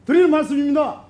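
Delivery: high-pitched, forceful protest; heated indignation. Real, not acted. Angrily denies lecturing the minister.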